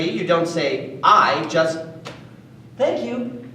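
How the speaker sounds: usual speaking voice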